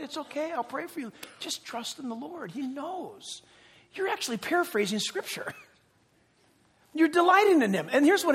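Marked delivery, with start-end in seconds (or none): 0.0-3.4 much softer, gentle comfort; calm a panicking friend quietly; reassuring. Retold or imitated. Believer soothing a frightened friend, soft and reassuring.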